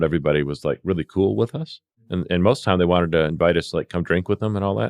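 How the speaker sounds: usual speaking voice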